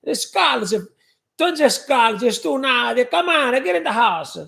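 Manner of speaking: high, loud scolding nonna voice; mock nonna yelling at barefoot kids; yelling Italian grandmother. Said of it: "when she used to yell at us".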